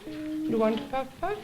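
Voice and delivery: ordinary talk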